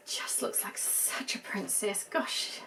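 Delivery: breathy hushed whisper of admiration; awed whisper. Real, not acted. Doll collector admiring the dress, whispering in awe. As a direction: whisper it as if the doll might break. Admiring the doll's long sleeves.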